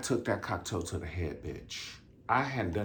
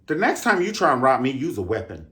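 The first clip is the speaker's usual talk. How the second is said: defiant shout; 4 semitones higher, 7 dB louder than usual. Real, not acted. Defiant address to robber.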